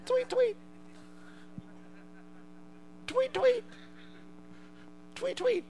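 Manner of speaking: high chirping tweet; bird tweet. Pretend. Preacher imitates the dove.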